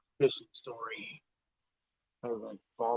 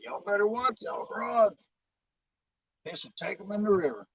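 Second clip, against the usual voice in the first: loud warning; 10 semitones higher, 8 dB louder than usual. Retold or imitated. Warning kids about their rods.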